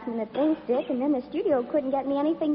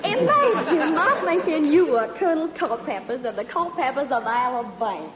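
Southern belle routine. Pretend. Actress flirts with a stranger, gushing Southern accent. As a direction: lay on a sugary Southern accent. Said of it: "my little old southern routine".

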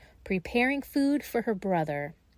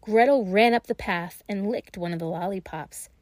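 ordinary delivery, nothing marked

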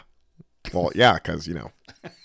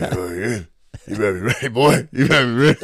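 Tom impression. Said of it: "doing Tom".

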